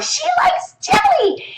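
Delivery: very high, slow called-out sentence; calling out jelly. Shown as a demonstration. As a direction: call the word out to the class. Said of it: "call out jelly".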